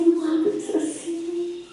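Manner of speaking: imitating a soft, slow complaint; soft reproach. Preacher imitating André objecting to his tone, soft and slow. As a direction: drop the volume and draw it out. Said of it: "cómo cambia mi voz con el André".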